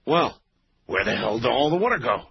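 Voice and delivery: usual speaking voice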